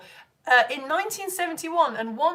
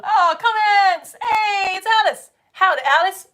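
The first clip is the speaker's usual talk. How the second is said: excited greeting; 8 semitones higher, 9 dB louder, slower than usual. Real, not acted. Spots her first viewer comments.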